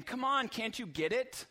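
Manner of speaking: exasperated rebuke to the disciples; impatient scolding. Retold or imitated. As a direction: stand over them and push. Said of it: "isn't standing over them saying".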